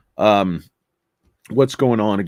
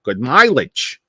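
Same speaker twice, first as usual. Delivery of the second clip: higher, hammed-up Northeastern accent; 7 semitones higher than usual. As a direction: brag in an exaggerated Northeast US accent. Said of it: "somebody in the North like".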